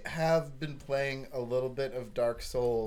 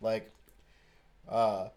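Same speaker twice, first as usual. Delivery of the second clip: ordinary delivery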